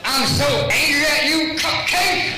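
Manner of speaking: high, loud mock fury, laughing through it; mock angry yell. Shown as a demonstration. Pastor acting out a spouse quarrel, laughing mock anger. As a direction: ham up a furious partner, still using the pet name. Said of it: "when you're yelling at someone".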